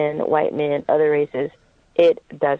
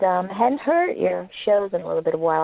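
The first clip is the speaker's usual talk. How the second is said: ordinary talk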